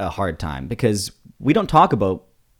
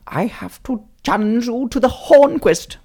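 higher, heroic video game hero voice; higher heroic Link voice throwing down a challenge; Link impression; 12 semitones higher than usual. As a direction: challenge him like a young sword hero. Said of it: "your Link impression".

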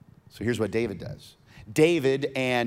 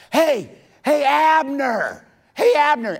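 high, loud long-distance holler; yelling out; 10 dB louder than usual. Acted. David calling to Abner from afar, yelling out. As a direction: yell down the mountain to get his attention. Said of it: "he starts yelling out".

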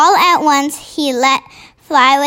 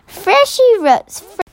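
higher, slow, drawn-out outcry; slow, raised-pitch exclamation; crying out in disgust; 5 semitones higher, slower than usual. A narrator's reading. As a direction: protest with slow emphasis. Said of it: "the B F G cried out".

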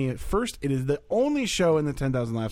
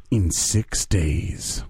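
slow dramatic announcer; 7 semitones lower, much slower than usual. Acted.

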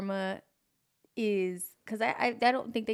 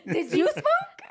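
laughing hard, high and loud; 4 dB louder, much slower than usual. Real, not acted. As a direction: burst out laughing mid-question. Teasing laugh at cohost.

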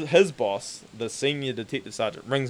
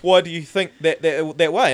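hesitant cop; 6 semitones higher, 10 dB louder, slower than usual. Retold or imitated.

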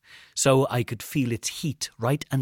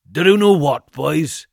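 loud, higher drawn-out taunt; taunting ringleader; 5 semitones higher, 11 dB louder than usual. A narrator's reading. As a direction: toy with the boys, loudly. Villain calls out to boys.

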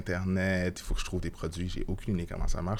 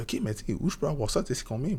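put-on curious kids; 8 semitones higher, faster than usual. Kids asking the price.

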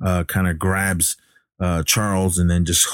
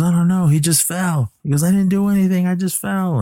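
put-on frightened boy; 12 semitones higher than usual. Damien denies it to family.

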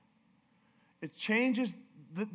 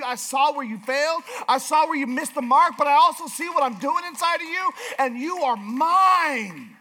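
raised, high-pitched preacher's voice, building; impassioned preaching; 6 semitones higher, 7 dB louder than usual. Real, not acted. Preaches at full force.

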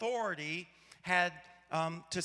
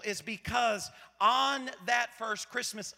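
ordinary talk

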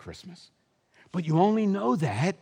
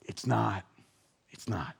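soft reassurance; 6 semitones lower, 7 dB quieter than usual. Real, not acted. Preacher softens for good news.

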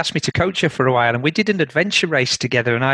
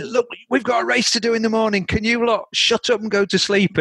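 imitating annoyed campers, pitched much higher; a mock complaint from the next tent, voice raised high; 8 semitones higher than usual. Neighbours in the campsite telling the drinkers to shut up, imitated high and complaining.

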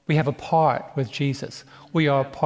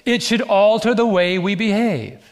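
preaching; 6 semitones higher, 4 dB louder than usual. Acted. Preaching builds.

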